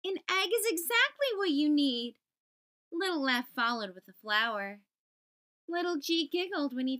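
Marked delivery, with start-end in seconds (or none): 0.0-2.2 bright, higher exclamation; exclaimed. Acted. Little E excitedly offering an egg, higher. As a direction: sell the egg with glee. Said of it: "Little E exclaimed".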